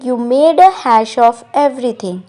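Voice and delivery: slow, clear English model line; slow model sentence. Shown as a demonstration. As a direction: spell it out gently for learners. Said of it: "English में कहेंगे". (धीरे और साफ़ अंग्रेज़ी वाक्य)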